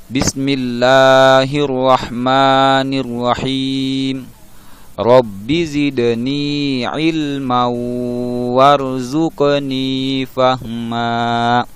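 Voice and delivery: slow chanted prayer; slow melodic doa before class; prayer recitation. Acted. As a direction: recite the prayer slowly and reverently.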